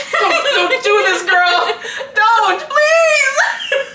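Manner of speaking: high, loud, slow begging, half crying; mock pleading. Friend begging her not to date him, mock pleading. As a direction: beg her friend, hammed up. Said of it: "being like".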